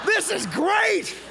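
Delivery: very high, drawn-out yell of delight; ecstatic shout. Retold or imitated. New guy raving to a griping coworker, high gleeful shout. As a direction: bellow it slowly with manic joy. Overjoyed reply to complaining coworker.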